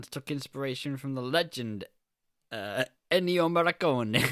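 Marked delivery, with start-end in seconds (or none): 3.1-4.2 mock Italian accent. Shown as a demonstration. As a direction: relish the name in a showy Italian accent. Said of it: "don't think that one is accented".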